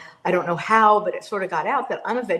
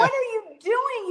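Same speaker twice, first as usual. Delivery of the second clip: much higher, exasperated yell at the football coach; mock screaming at the TV; 13 semitones higher than usual. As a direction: yell at the screen like a frustrated fan. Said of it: "but I'm screaming".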